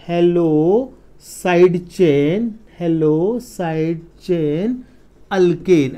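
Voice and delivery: very slow, long held syllables; slow dictation. Shown as a demonstration. Teacher writing the naming rule for students, slow dictation. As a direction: spread the words out at writing speed.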